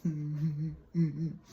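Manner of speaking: high whimpering fake sobs; mock sobbing. Girl running to her best friend, mock sobbing. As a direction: imitate a crying girl with short whimpers. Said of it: "going to her best friend".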